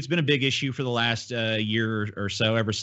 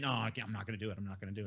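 much softer, muttered to himself; holding himself back; 2 semitones lower, 15 dB quieter, much faster than usual. Retold or imitated. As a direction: talk yourself out of it under your breath. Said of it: "where I'm like".

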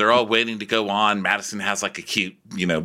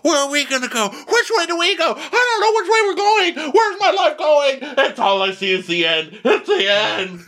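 much higher, louder spiralling panic; mock panicked groomsman; 4 dB louder than usual. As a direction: squeal in rising existential dread. Confused wedding party voiced.

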